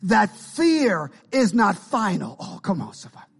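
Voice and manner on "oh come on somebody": ordinary talk, nothing marked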